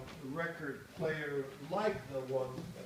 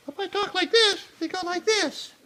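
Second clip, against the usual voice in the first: much higher and louder, squeaky demo; 12 dB louder than usual. Shown as a demonstration. Guide showing visitors a high squeaky voice. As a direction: jump way up high and loud.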